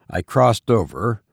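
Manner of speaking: ordinary delivery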